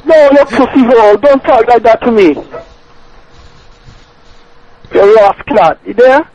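angry Jamaican patois. Acted. Caller's angry patois curse.

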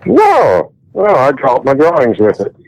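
usual speaking voice

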